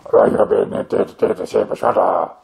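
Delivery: badly recorded echo-room voice; echoey room voice. Shown as a demonstration. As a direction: sound like you're talking in a bare echoing room. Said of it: "But with this voice, like".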